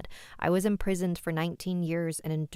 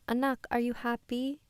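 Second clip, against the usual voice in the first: a light, put-on Filipina mother's accent; terrible accent; 7 semitones higher than usual. Mom suddenly asks her daughter at dinner, in a mock Filipina accent. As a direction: ask it like a Filipina mom with a badly faked accent. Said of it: "That's my terrible accent".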